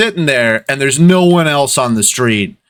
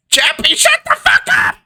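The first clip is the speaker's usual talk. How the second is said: very high screechy offstage yell; shouting from inside. Acted. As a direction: shriek an order out the door at a kid. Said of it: "a different voice shout out".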